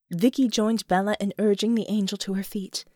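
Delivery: plain reading voice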